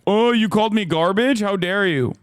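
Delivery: high, indignant mock outrage; mock offended Republican. Mocking Republicans who cry foul. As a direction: sneer at their fake offence. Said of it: "Republicans being like".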